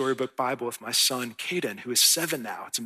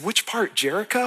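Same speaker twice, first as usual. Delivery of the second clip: put-on higher, louder, eager kid voice; imitating his son; 8 semitones higher, 4 dB louder than usual. Pastor voicing his son, higher and eager. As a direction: play the seven-year-old hopefully asking for Jericho. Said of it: "And he said".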